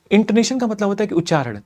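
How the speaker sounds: fast demo take, rattled off; sped up. Shown as a demonstration. Teacher showing students the fast speed, rapid delivery. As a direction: race through it to show the fast speed. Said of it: "अब मैं अपनी speed को बढ़ाता हूँ".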